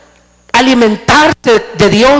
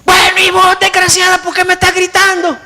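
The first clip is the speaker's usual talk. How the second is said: mock angry retort; 8 semitones higher than usual. Husband snapping back at his shouting wife, mock angry. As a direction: play the husband yelling back at her.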